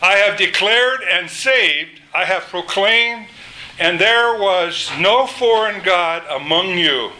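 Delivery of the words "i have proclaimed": normal talking voice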